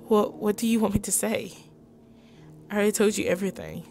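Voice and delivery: ordinary talk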